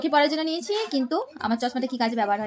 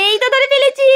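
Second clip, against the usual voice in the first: high, loud, gleeful "got you"; excited catch; 12 semitones higher, 8 dB louder than usual. Real, not acted. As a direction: pounce on the words with playful glee. Playfully catching the child. (উচ্ছ্বসিত হয়ে ধরে ফেলার ডাক)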